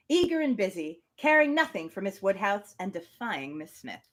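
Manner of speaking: measured reading of a classic novel; story narration. Acted. Podcast host reading Emma aloud, measured narration. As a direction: read it like an audiobook narrator.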